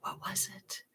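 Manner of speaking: unvoiced whisper, thinking aloud; whispered to herself. Real, not acted. Streamer forgets her point and whispers to herself. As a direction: whisper it under your breath as you search for the thought. Losing her train of thought.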